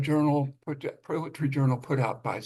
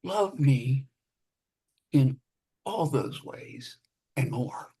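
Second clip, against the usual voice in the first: slow measured poetry reading; poem reading. Acted. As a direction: read it like a poet, unhurried. Poet reads own poem.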